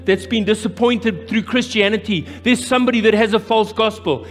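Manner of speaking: preaching at rising force; rising preacher. Acted. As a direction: drive the message home to the room. Preaching to the congregation.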